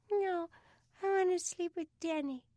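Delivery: put-on high, small child's voice; little girl. Sarah refusing her brother's offer, high little-girl voice. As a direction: pipe up like a tiny child.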